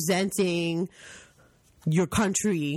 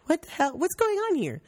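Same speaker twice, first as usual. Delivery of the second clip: high, incredulous what-the-hell; mock bafflement; 10 semitones higher than usual. Spotting a pin-wearing Canadian abroad, high and baffled. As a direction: act baffled and exasperated. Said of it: "I'm like".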